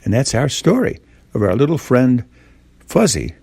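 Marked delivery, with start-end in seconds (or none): none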